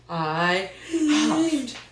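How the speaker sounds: slow huffing building high and loud; huff, snuff, then the big sneeze. Acted.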